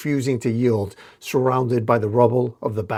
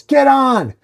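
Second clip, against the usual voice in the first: much higher, loud yell; urgent shout; 13 semitones higher, 8 dB louder than usual. Acted. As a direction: shout over the traffic for her to jump on. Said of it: "and yells".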